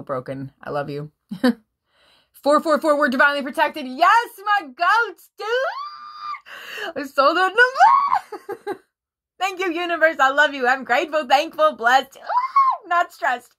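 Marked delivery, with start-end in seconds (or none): 2.3-8.8 high, loud, gleeful shouting; squealing with joy at the cards; excited squeal. Real, not acted. Tarot reader squealing at viewers in delight. 9.4-13.5 high, loud, giddy gratitude; gushing thanks through laughter; ecstatic thanks. Real, not acted. Tarot reader gushing gratitude to the universe, giddy.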